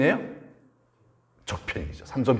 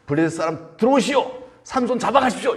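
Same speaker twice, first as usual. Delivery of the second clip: high, raised summoning call; called-out signal; 10 semitones higher, 3 dB louder than usual. Acted. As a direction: summon the soldiers to seize him. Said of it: "신호죠?".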